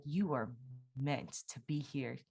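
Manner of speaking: quiet, tender voice of a kind roommate; hushed, sincere encouragement; soft reassurance. Retold or imitated. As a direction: comfort her gently and softly.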